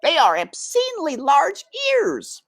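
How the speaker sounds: put-on high, fussy old aunt voice; Aunt Florence. A narrator's reading. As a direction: squeak it out like a prim old aunt mouse. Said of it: "said Aunt Florence".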